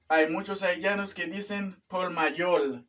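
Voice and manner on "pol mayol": "por mayor" said as "pol mayol"; "por mayor" mispronounced with L for each R; learner's L for R. Retold or imitated. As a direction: imitate the mistake, "por mayor" with L endings. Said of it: "No es por mayor".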